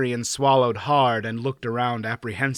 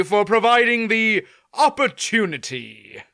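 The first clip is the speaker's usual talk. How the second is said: higher, louder courtly knight voice; a knight confessing his eagerness for battle; earnest courtly knight, frank; proud young knight; 7 semitones higher, 5 dB louder than usual. Acted. Mander Alan knight voice.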